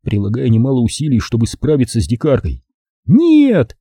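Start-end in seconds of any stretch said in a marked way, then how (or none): 3.1-3.8 put-on high girlish protest; shrieking no. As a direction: throw out a high no. Zaza argues while carried.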